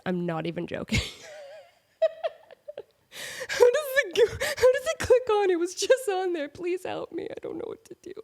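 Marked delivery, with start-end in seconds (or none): 3.5-7.2 mock crying. Vlogger reenacting fighting her tripod near tears. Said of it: "nearly brought me to tears". 7.3-8.1 mock despairing whisper. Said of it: "nearly brought me to tears".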